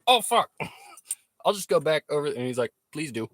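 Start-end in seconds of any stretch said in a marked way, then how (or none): 0.1-2.3 loud, high comic panic; retold alarm. Streamer replaying his shock on learning he's in Canada, comic alarm. Retelling own alarm at border. 2.9-3.3 slightly raised, louder Canadian voice; polite Canadian accent. Retold or imitated. Streamer playing the Mountie sending him back, polite Canadian accent. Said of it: "they were right about the polite thing".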